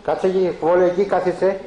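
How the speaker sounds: loud, high commands to the dog; calling the dog. Real, not acted. Owner ordering the dog to come and sit, loud and high. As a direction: raise the voice and order him to sit.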